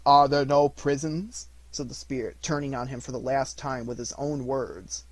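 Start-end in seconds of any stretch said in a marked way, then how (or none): 0.0-1.5 loud, slow, biting echo; mocking ghost. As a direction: throw his words back at him. Spirit throws his own words back.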